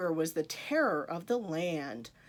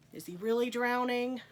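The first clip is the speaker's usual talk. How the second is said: ordinary delivery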